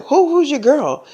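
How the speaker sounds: a hint of a suspicious girl; Madison, suspicious. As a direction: demand it in a high put-on voice. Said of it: "So she's like".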